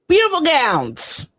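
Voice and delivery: [name] quote. Retold or imitated. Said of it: "As [name] once said".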